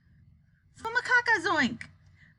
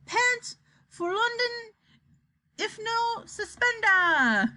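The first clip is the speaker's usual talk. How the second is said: high, drawn-out strange words; slow, steely incantation; 10 semitones higher, slower than usual. A narrator's reading. Wonka addressing the world in strange words, slow and steely. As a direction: stretch each phrase, let the endings slide down. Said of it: "The words came more slowly, but there was a touch of steel in every syllable".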